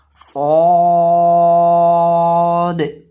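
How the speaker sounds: loud सौद with a thick vowel held very long; sustained, emphatic सौद demo; heavy, full letter. Shown as a demonstration. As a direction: make सौद full-mouthed and chant it slowly. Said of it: "सौद को भी पुर करके पढ़ेंगे".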